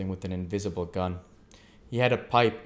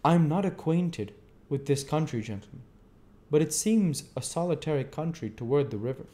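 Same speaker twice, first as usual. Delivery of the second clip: the stranger; 7 semitones higher than usual. A narrator's reading. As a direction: voice the odd stranger, curious. Stranger probes about the country.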